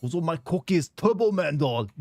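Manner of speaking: Arnold bursting with excitement; eager Austrian accent; excited Arnold. Retold or imitated.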